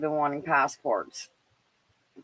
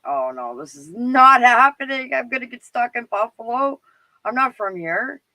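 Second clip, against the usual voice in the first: higher, louder mock panic at the border; retold panic; 6 semitones higher, 5 dB louder than usual. Stuck at the border, retelling her panic to the guard, higher and louder. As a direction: relive the border scare with rising alarm. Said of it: "I was starting to freak out".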